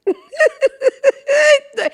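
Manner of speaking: high cracking laugh; high shrieking laugh. Real, not acted. Cracking up at her own joke, high laugh.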